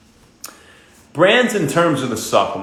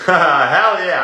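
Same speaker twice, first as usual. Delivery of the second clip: laughing; 5 semitones higher, 5 dB louder than usual. Real, not acted. Laughing at a chat comment.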